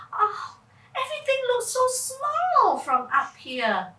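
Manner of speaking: high, delighted wonder; amazed. Acted. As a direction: marvel at the tiny world. Amazed at the view.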